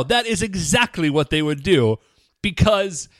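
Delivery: ordinary talk, nothing marked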